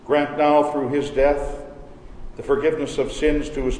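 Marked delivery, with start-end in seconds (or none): none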